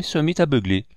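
ordinary delivery, nothing marked

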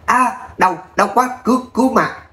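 high, strained yelps of pain; pained cries for help. A narrator's reading. Beaten thugs wailing for help in high pained cries. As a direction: cry out as if being beaten. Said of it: "Tiếng la thảm thiết".

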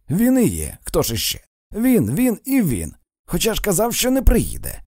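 acted fed-up protest, pitched high; exasperated. A narrator's reading. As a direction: hammer it out with rising irritation. Son exasperated at father's arrival.

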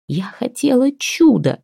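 high, drawn-out wail; acted tearful outburst; bawling. A narrator's reading. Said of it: "ревела Ляля".

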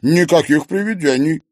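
loud, high-pitched firm denial; insistent. A narrator's reading. Maryana denies there are any ghosts, insistent. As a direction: shut the idea down insistently. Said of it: "твердила она".

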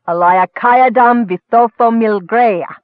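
drawn-out, commanding spell; shouted incantation. A narrator's reading. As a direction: chant the magic words forcefully. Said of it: "shouted the elf".

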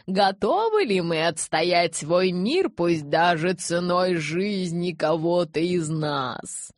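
very slow, drawn-out appeal; rallying oratory. A narrator's reading. Elder rallying followers to defend their world, slow oratory. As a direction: orate grandly, stretching each phrase.

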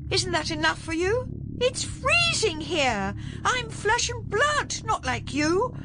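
very high, haughty bluster; indignant Duchess voice. A narrator's reading. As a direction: protest like an affronted duchess. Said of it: "putting on what Ben would have called her best Duchess voice".

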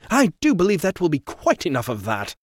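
smooth, superior intervention; fussy gentleman. Acted. Trevor voiced.

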